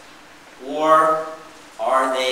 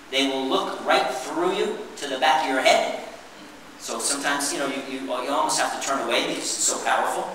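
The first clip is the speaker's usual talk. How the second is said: normal talking voice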